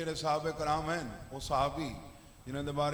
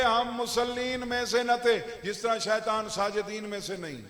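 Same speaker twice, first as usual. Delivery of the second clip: raised, emphatic sermon delivery; forceful preaching; 3 semitones higher, 5 dB louder than usual. Real, not acted. Preacher setting deniers of Ali beside Satan, in forceful oratory. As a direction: press the comparison home briskly and loudly.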